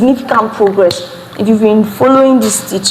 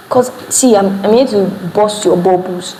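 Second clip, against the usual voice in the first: ordinary talk, nothing marked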